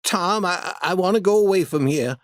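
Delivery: put-on a touch of fear, halting boy; nervous stammering plea to leave; shaking voice. A narrator's reading. Frightened Huck begs Tom to leave the cave, mild shaky voice. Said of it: "Huck said in a shaking voice".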